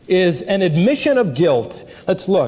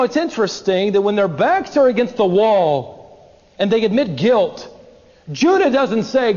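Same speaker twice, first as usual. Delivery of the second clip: usual speaking voice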